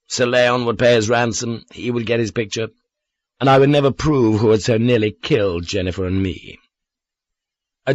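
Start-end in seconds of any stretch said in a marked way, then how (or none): none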